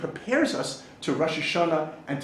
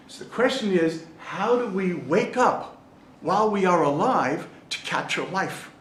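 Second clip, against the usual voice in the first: usual speaking voice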